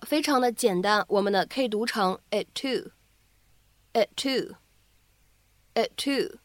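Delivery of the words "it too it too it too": "it too" with only one released t; joined "it too", first t silent but held; loss of plosion. Shown as a demonstration. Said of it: "失去爆破".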